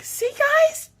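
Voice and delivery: high, squeaky, slow fake cheer; hammed-up reassurance through tears; tearful brave face. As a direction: squeak it out, pretending it was fine. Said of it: "I have a tear coming down my face".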